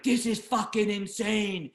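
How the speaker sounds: high-pitched, exasperated protest, acted out; retold angry yell. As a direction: re-enact yelling back in disbelief, drawn out. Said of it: "then I start yelling at him".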